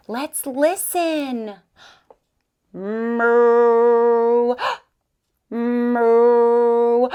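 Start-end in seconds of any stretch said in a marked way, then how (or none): 2.7-7.1 cow moo. Pretend. Teacher voices cow for game.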